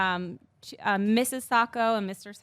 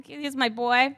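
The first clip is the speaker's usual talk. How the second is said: normal talking voice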